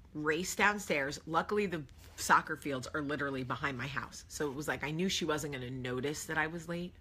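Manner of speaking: normal talking voice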